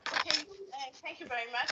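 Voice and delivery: very faint and distant; speaking far from the mic; barely audible. Real, not acted. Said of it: "Your voice is very faint".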